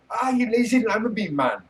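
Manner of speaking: put-on high, loud Jamaican accent; mock Jamaican accent, hammed-up. Streamer teasing George in a mock Jamaican accent.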